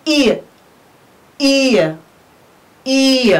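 e as "ee-uh", said three times; separate, clearly modelled E; diphthong demo. Shown as a demonstration. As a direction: glide E from ee into schwa. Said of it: "We pronounce it as".

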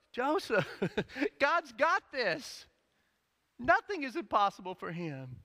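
high, loud and laughing, God's got this stretched slow; joyful insistence; excited reminder. Acted. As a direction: lift Joseph up with a laugh. Mary voiced reminding Joseph.

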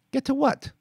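put-on very high child's question; puzzled little boy. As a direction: ask it like a confused kid. Boy asks.

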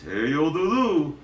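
sing-song cartoon bear voice; Yogi Bear. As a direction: talk like a jolly cartoon bear, rising at the end. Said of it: "I can do Yogi Bear".

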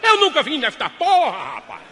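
furious denial. Acted. Husband pounding the table, furious shout. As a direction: yell it, fist on the table. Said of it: "deu um murro na mesa e disse".

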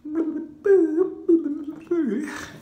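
high, loud screeching cackle; rabbit dinosaur call. Pretend. Showing viewers how the Rabosaur sounds, high loud screech. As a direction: shriek like a silly monster. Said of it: "it sounds like this".

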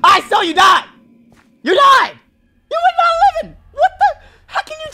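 rising furious protest; exasperated shouting. Real, not acted. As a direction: yell it at her in outrage. Arguing with the scientist.

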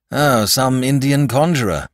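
light. A narrator's reading. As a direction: brush it off lightly. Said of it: "said the doctor lightly".

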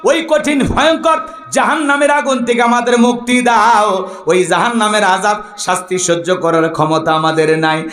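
plea opening high, then descending; melodic preaching. Acted. Preacher pleading with the Lord for rescue, melodic preaching. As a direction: cry out to God in sermon chant.

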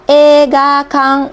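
teacher spelling out Ega kang slowly for the class; slow model reading. Shown as a demonstration. Teacher to livestream students, slow clear model reading. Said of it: "teacher models new word".

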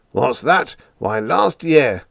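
indignant bluster. A narrator's reading. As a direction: huff and puff at him. Captain blustering in argument.

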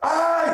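high, loud "ouch" yelp; sudden mock pain shout; acted cry of pain. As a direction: yelp as if a tooth is tapped. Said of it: "אם אתה צועק".

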